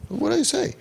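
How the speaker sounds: very high, put-on innocence; squeaky-high defensive question; mock innocent protest. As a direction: imitate a wide-eyed denial in high pitch. Mimics defensive adults who reject kids.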